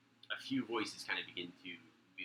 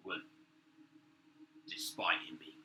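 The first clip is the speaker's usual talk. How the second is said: usual speaking voice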